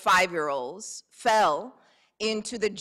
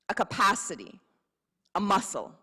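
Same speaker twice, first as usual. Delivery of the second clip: ordinary delivery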